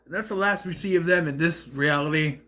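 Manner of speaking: ordinary talk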